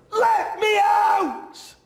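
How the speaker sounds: very high, loud, slow shout breaking into a cry. Acted. Boy trapped inside, crying as he screams to get out. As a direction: sob and scream it at once.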